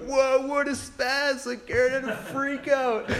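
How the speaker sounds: slow, high, drawn-out taunt; mocking kid. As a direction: stretch it out like a sneering schoolkid. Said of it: "another kid's like".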